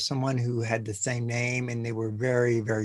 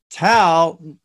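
calling out; 4 semitones higher, 8 dB louder than usual. Retold or imitated. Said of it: "congregation said".

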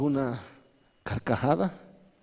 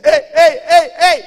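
high, very loud repeated calls; Satanás shouting; 14 semitones higher, 19 dB louder, faster than usual. Acted. Devil interrupting the demons' party, alarmed shouting. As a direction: yell urgently for attention. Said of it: "Satanás dice".

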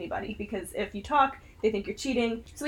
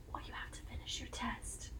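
very soft whisper, meant for one student only; 20 dB quieter than usual. Retold or imitated. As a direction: imitate a teacher's hushed whisper. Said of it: "leaned down and whispered to me".

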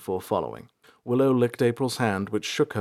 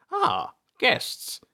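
put-on old man voice. A narrator's reading.

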